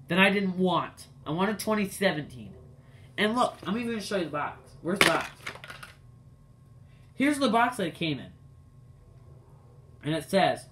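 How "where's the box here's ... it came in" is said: ordinary delivery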